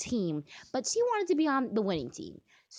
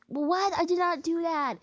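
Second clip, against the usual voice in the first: higher-pitched protest; indignant disbelief; 7 semitones higher than usual. Real, not acted. Player disputing what the game just did, indignant.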